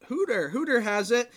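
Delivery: mock-urgent reply; retold urgency. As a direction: pass the blame on urgently. Retells crew member's answer.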